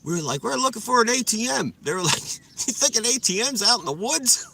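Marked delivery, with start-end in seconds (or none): 0.4-1.7 higher, fast, innocent answer; mock drunk answer. Drunk telling the cops about the ATM, high and fast. 2.2-4.5 higher, laughing disbelief; mock them like an amused cop. Cop mocking the drunks, higher and amused.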